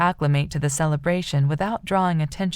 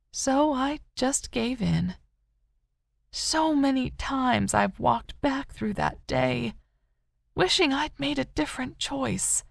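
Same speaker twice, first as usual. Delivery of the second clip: higher, hushed, slowed confession of guilt; guilt-ridden; 7 semitones higher than usual. A narrator's reading. Rose confesses her guilt to Katie, hushed and aching. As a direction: she can't forgive herself for that day. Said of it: "guilt radiating from Rose".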